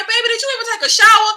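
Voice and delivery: high, rapid disbelief; mock-appalled quick question; scandalized reaction. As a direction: fire it off in high-pitched disbelief. Acts her scandalized reaction to scene.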